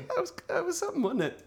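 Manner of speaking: high falsetto mock admiration; sarcastic. Host joins mocking the pun, high sarcastic falsetto.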